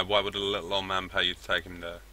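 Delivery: ordinary delivery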